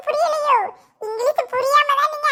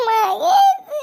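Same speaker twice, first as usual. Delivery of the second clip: crying; 2 semitones higher, 4 dB louder, much slower than usual. Acted. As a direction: wail the name out tearfully. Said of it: "எதுக்குமா அழுக".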